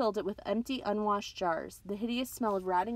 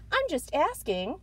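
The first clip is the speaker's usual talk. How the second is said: innocent; 8 semitones higher, 3 dB louder, slower than usual. Acted. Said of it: "trying to sound innocent".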